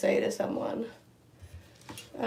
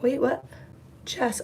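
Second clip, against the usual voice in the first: ordinary talk, nothing marked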